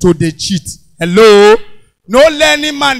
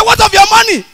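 robber shout; 11 semitones higher, 4 dB louder than usual. Retold or imitated. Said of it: "Armed robbers".

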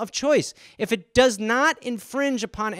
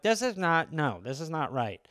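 quiet disapproval; 6 semitones lower, 10 dB quieter than usual. Retold or imitated. Acted disgusted reaction to marmalade.

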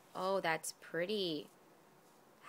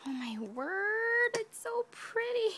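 awed whisper; 12 semitones higher, 4 dB louder than usual. Real, not acted. Amazed at the craft.

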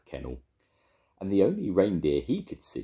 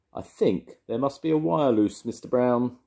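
higher and louder exclaiming; 8 semitones higher, 6 dB louder than usual. A narrator's reading. Paddington points out the lighthouse to Mister Brown, exclaiming higher and louder. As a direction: blurt it out, alarmed. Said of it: "he exclaimed".

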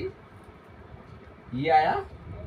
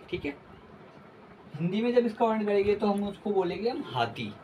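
usual speaking voice

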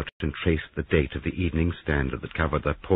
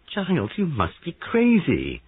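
high, loud and drawn out; appalled disbelief; 12 semitones higher, 5 dB louder, slower than usual. Acted. Molly reacting to her husband's accusation, appalled. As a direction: stretch it out in disbelief.

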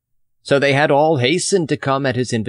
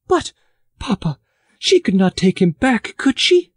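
put-on higher, pleading young woman's voice; a girl anxiously asking her father, rising; worried daughter; 11 semitones higher, 3 dB louder than usual. A narrator's reading. Catherine asks her father anxiously, higher young voice.

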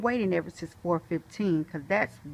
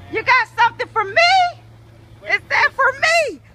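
much higher and louder, thrilled; overjoyed the package is here; excited; 13 semitones higher, 11 dB louder than usual. Real, not acted.

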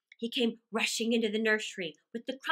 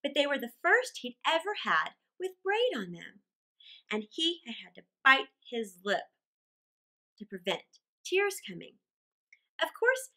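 usual speaking voice

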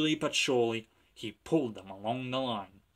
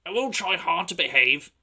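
eager engine; 6 semitones higher, 8 dB louder than usual. Acted. James promising.

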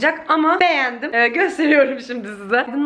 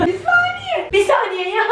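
straining; 12 semitones higher than usual. Real, not acted. Straining to lift heavy piece.

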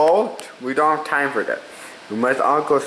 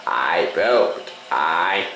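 deep goofy voice; low silly voice; 10 semitones lower, much slower than usual. Pretend. Silly chant in varied voices.

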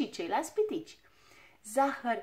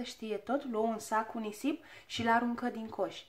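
plain reading voice

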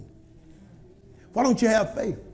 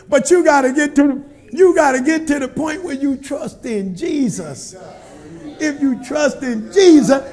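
full-force preaching; 3 semitones higher, 7 dB louder than usual. Acted. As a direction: preach it to the congregation.

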